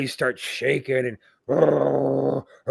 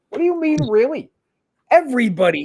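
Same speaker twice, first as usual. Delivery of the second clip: incredulous; 9 semitones higher, 5 dB louder than usual. Retold or imitated. Telling Porter everyone knows him.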